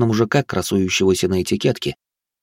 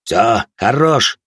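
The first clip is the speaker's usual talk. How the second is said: drawn-out, louder bark; barked order; 3 semitones higher, 5 dB louder, much slower than usual. A narrator's reading. Officer ends the bickering and demands business, barking. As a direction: cut him off with a firm, stretched-out shout. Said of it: "рявкнул Морозов".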